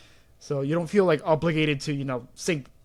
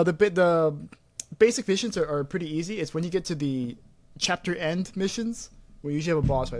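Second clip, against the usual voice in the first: normal talking voice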